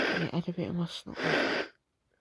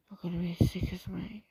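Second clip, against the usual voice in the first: ordinary talk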